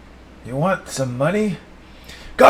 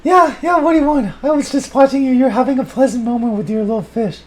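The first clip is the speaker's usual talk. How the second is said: high, loud put-on woman's voice; Sally chiming in on the fish moment, high and loud; Sally's voice; 11 semitones higher, 4 dB louder than usual. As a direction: answer as Sally in a high falsetto.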